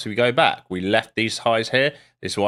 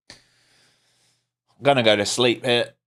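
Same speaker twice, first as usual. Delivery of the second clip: sleepy mock; much slower than usual. Bored trader mocks slow market.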